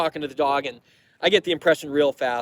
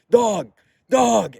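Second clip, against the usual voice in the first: calling out to Dog; 5 semitones higher, 4 dB louder than usual. Retold or imitated. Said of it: "he's like".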